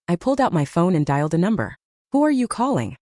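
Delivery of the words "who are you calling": higher-pitched demanding question; mildly indignant demand. A narrator's reading. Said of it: "Dad demanded".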